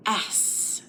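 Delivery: "S" as a long voiceless hiss through the teeth; hissed S sound. Shown as a demonstration. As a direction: push air between the teeth: "S". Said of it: "Push that air right between your teeth".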